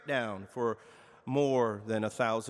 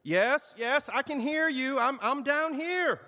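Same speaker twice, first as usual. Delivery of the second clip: calling up; 11 semitones higher, 3 dB louder than usual. A narrator's reading. Jack answers from the cliff.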